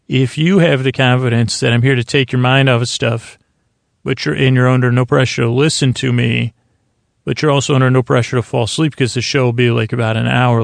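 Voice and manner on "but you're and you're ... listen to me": ordinary talk, nothing marked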